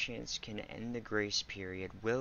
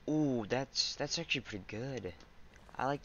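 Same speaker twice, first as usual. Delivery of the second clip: excited; 4 semitones higher, 4 dB louder than usual. Real, not acted. Delighted by game feature.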